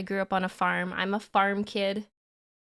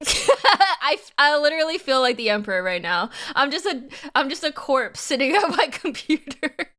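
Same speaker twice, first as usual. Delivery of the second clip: laughing; 4 semitones higher, 4 dB louder than usual. Real, not acted. Laughing about feeling like corpse.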